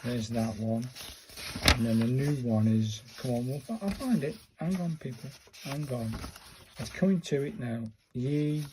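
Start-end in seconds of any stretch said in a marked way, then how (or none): none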